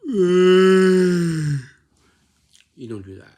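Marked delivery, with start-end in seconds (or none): 0.0-1.6 big, long yawn, high and very loud; exaggerated yawning noise, sliding slowly down; loud demo yawn. Shown as a demonstration. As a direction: let out a huge drawn-out yawn. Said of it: "like this".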